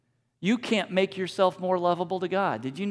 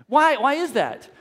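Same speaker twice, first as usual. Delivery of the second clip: ordinary talk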